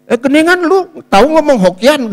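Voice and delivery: seruan heran, tinggi dan keras; kaget. Retold or imitated. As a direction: tirukan orang terkejut dengan suara melonjak. Meniru kakak ipar yang kaget. (berseru keheranan)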